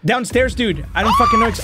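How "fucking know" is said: panicked squeal. Real, not acted. Monster suddenly near.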